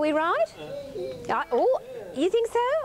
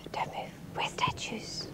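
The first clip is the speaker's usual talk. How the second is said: whisper; 8 semitones higher, 10 dB quieter than usual. Pretend. Statues game, keeping still.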